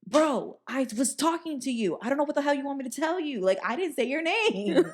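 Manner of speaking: high-pitched exasperated imitation of a friend; mock-indignant, high and insistent; exasperated protest. Retold friend protesting his innocence.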